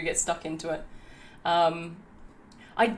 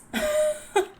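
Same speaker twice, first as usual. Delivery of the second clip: high giggle; 3 dB louder than usual. Real, not acted. After the excited squeal.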